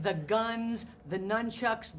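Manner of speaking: ordinary talk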